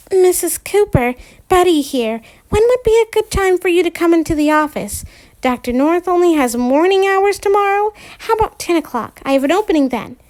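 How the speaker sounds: lightly voiced chirpy receptionist; bright office phone manner.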